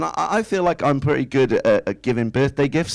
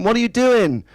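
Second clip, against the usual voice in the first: calling from another room; 8 semitones higher than usual. Retold or imitated. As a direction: call out as if through a wall. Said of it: "calls from the other room".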